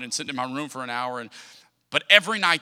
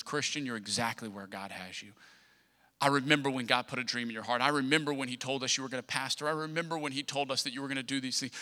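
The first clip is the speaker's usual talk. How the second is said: tender mother; 4 semitones lower, 5 dB quieter, faster than usual. Retold or imitated. Mother's voice retold in sermon.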